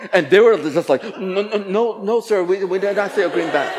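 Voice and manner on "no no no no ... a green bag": apologetic staff denial. Retold or imitated. Staff nervously deny seeing bag.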